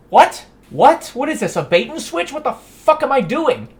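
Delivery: sudden loud high outburst, What? What?; loud incredulous yell; disbelief. Real, not acted. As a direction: shout it out in stunned outrage. Outraged at six-month-old job postings.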